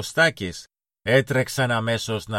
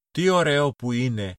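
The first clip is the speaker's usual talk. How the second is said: put-on mild child's exclaim; 4 semitones higher than usual. A narrator's reading. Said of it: "φώναξε ο Κωστάκης".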